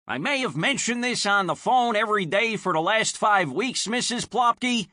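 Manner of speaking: shouting. Acted. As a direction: shout through the door, fed up. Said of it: "he shouts".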